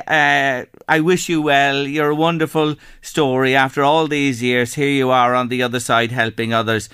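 ordinary delivery

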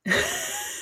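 short, high giggle; soft real burst of laughter. Real, not acted. Friends joke about American reactions, high giggle.

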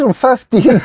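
high laughing claim of fifteen; can barely get the lie out for laughing; laughing through it. Retold or imitated. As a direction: lie about your age while cracking up. Lying about age, laughing.

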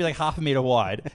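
ordinary delivery

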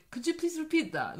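high-pitched, mock-polite foreigner on the phone; comic high foreigner voice; baffled foreigner. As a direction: play a clueless foreign operator asking for a repeat. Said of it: "your local foreigner person going".

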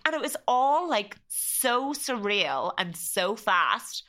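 usual speaking voice

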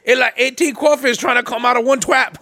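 put-on high, loud Cajun old man hooting; Cajun accent. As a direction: hoot it out in a thick Cajun accent. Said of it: "insulted in Cajun".

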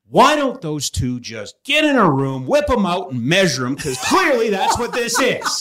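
mock on-air outburst; blurted out. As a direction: blurt it out on air, half laughing. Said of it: "I just blurted out".

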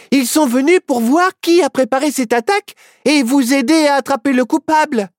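put-on high, earnest child voice; eager kid voice. A narrator's reading. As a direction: plead it high and keen. Friends answer the sheriff.

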